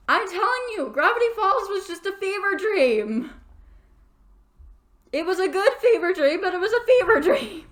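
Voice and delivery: high, loud, emphatic; excited. Real, not acted. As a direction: gush about the show with full energy.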